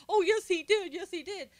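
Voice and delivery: high, loud, sing-song mocking voice; mocking Dick Durbin. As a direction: taunt it like a tattling schoolkid, pitched high. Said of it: "Dick Durbin ran out and said".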